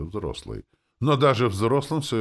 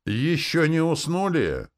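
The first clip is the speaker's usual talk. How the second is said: high, loud, drawn-out stage call; calling to audience; 12 semitones higher, 6 dB louder, slower than usual. A narrator's reading. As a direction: rouse the crowd from the stage. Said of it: "Зяба обратился к публике". (громкий выкрик ведущего публике)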